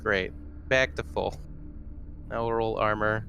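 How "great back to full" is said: normal talking voice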